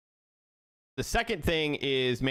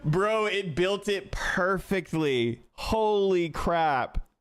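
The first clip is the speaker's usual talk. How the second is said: high, stretched-out astonishment; real awe, slow and raised in pitch; amazed; 6 semitones higher, much slower than usual. Real, not acted. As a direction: drag out the words in delighted disbelief. Amazed the tool worked.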